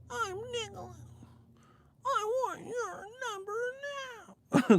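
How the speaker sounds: soft, very high falsetto; annoying media voice. Retold or imitated. Mocking a media guy asking for a number, squeaky falsetto. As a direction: squeak out a mock pickup line. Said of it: "These old media voices just be annoying".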